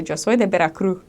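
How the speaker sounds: Veracruz Spanish with the final S dropped; Veracruz accent. Retold or imitated. Someone from Veracruz introducing themselves, Veracruz accent. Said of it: "drop the S sounds off of all of the ends".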